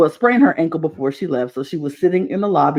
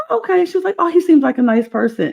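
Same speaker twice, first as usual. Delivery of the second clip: naive sister's voice; 11 semitones higher, faster than usual. Retold or imitated. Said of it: "And she was like".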